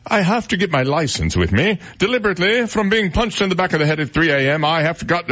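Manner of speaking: high-pitched mock Prince Charles British accent. Host reading a pilot's quote for fun, mock British accent. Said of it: "Should I do my British accent?".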